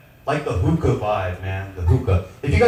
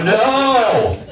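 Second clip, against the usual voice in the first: higher, louder panicked no; 10 semitones higher, 4 dB louder than usual. Retold or imitated. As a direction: cry out no in alarm. Panicked retold cry.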